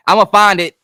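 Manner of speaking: loud, emphatic promise; loud resolve to find the meaning; determined vow. Real, not acted. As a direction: declare it with force. Vowing to find the number.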